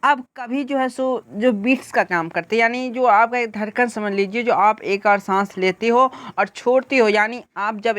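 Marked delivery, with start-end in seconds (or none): none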